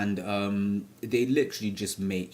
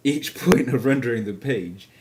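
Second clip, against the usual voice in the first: laughing through the line, louder; 4 semitones higher, 8 dB louder than usual. Real, not acted. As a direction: laugh as you restart the sentence. Restart after fumbling the sentence.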